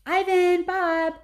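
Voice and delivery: high, loud wake-up call. A narrator's reading. A young elephant calling her friends, loud. Ruby calls out at dawn.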